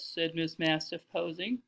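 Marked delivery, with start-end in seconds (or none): none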